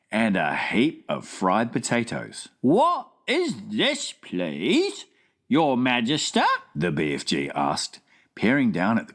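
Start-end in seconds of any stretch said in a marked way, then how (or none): 2.6-6.4 lightly voiced friendly giant, slow wondering question; BFG voice. Giant asking the Queen at breakfast, wondering. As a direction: puzzle it out like a big gentle giant.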